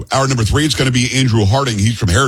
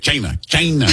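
"China" higher, imitating the president; playful presidential impression on "China"; president's "China"; 4 semitones higher than usual. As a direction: mimic the president relishing the word "China". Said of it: "every time he says it, he says".

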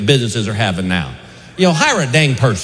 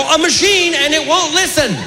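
whiny; 12 semitones higher than usual. Retold or imitated. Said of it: "I go".

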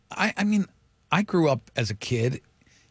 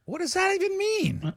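incredulous; 14 semitones higher than usual. Real, not acted. Incredulous reaction to saying.

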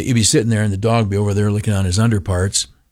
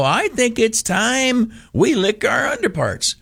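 mock union voice; 10 semitones higher than usual. Mocking the unions' voice.

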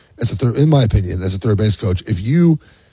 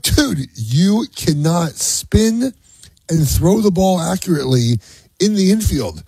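mock lecture; 8 semitones higher, slower than usual. Dad dismissing son's claim.

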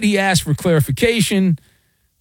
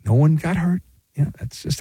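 ordinary talk, nothing marked

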